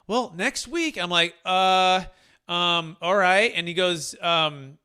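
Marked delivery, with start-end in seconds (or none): 0.0-1.0 high-pitched imitation of a Boston client; breezy reply in a mock Boston accent; [name]'s attempted Boston accent. Client answering when they close, mock Boston accent. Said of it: "he's like the great Boston accent". 1.4-3.5 loud, slow, drawn-out stammer; stunned hesitation. Retold or imitated. Agent hearing closing is next week, stunned hesitation. Retelling his stunned reply to client.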